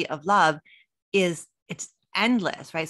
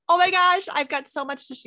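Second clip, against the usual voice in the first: high, loud excited gush; 12 semitones higher, 4 dB louder than usual. Retold or imitated. On feeling wiser at midlife, an excited gush. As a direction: burst out with excitement. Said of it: "I'm like".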